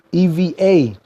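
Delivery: letter by letter: E V A, the way ever is said; spelled E V A. Shown as a demonstration. Host spelling the slang for viewers: E V A. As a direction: spell the word out as E V A, not E V E R. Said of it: "Not E V E R".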